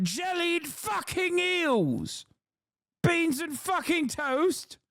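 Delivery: high, slow, hammed-up British oratory; mock British accent. Acted British rebuttal, continued after laugh.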